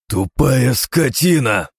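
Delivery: high, loud, slow roar of hatred; hateful roar. Acted. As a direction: thunder the insult. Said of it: "загрохотал с ненавистью в голосе я". (громогласно, с ненавистью)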